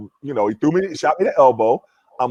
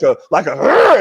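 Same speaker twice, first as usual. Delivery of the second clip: growl in his voice; 5 dB louder than usual. Retold or imitated. Retelling the GM's angry phone call with a growl. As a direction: rumble out an angry rrr. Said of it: "in his voice".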